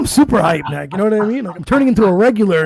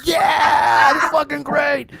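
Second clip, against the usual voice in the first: high, shouted glee at the chaos; whooping with delight while watching friends flee; gleeful howl; 7 semitones higher, slower than usual. Retold or imitated. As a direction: cheer it on like it's a movie. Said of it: "I'm howling like it's a movie".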